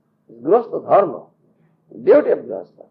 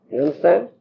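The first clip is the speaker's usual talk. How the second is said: ordinary talk, nothing marked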